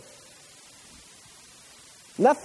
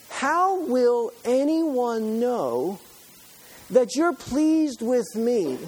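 slow, drawn-out, higher pleading; Moses' plea read slow and raised; slow earnest plea; 7 semitones higher, slower than usual. Acted. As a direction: stretch each word, pleading.

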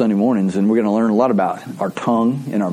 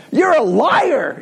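put-on high, drawn-out child's accusation; mad little kid; 12 semitones higher, much slower than usual. Little boy accusing his mom, high mock outrage. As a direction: imitate an outraged small boy. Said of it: "she gets really mad. And the little boy goes".

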